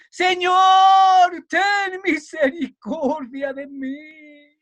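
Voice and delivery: high, loud, drawn-out cry; clamando más fuerte. Acted. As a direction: shout the plea for mercy with full force. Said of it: "clamaba mucho más y decía más fuerte". (grito de súplica a toda voz)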